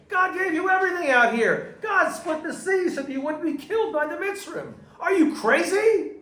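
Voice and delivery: high, loud, outraged tirade; angry rebuke. Acted. Teacher acting out Moshe defending God to the complainers, angry rebuke. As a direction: shout it indignantly, berating them. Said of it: "that would be responding in anger".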